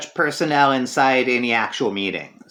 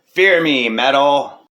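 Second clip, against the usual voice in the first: louder, processed voice-changer villain; menacing line through a voice changer; filtered voice; 4 dB louder, slower than usual. Acted. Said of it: "Here's some more of our new X-Men filter".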